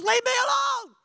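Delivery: high shouted outburst; angry God. Acted. God dismissing Moses so he can destroy them, angry shout. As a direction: shout it as a wrathful command. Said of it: "that's why God was so angry".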